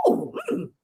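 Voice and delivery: high, hushed mock mama; a hint of a startled mother, high; lightly voiced mom, puzzled; imitating mama. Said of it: "my mama said".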